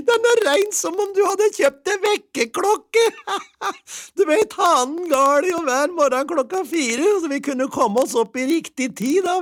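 very high, loud squeal with cackling laughs; ecstatic wife. A narrator's reading. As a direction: squeal with joy, laughing after each thought. Plays the delighted wife.

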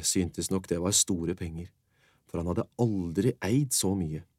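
ordinary delivery, nothing marked

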